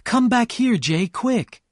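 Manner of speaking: high, loud urgent call; cry out. A narrator's reading. Daisy calls Gatsby to the window, high and urgent. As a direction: call him over, sudden and eager. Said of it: "Daisy suddenly cried out".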